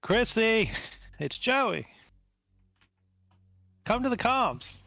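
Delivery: high-pitched call over the comms; calling out to wife. Acted. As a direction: call her up on the comm line.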